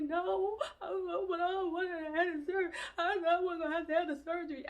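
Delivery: high, teary protest with a laugh in it; retold crying. Begging the doctor to skip surgery, crying high. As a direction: plead through half-laughing tears. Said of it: "started crying".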